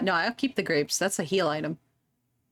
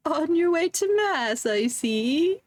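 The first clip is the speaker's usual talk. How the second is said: high, slow granny voice; old lady voice; 8 semitones higher, slower than usual. Acted. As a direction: croon like a sweet old granny. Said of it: "I don't know how to do an old lady voice".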